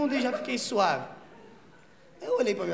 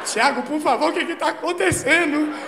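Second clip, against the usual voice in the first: raised, loud mock desperation; desperate pleading; 6 semitones higher, 7 dB louder than usual. Stoned partner begs Thiago to explain, acted desperation. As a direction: plead frantically, imitating a panicking woman. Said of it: "começou a ficar desesperada".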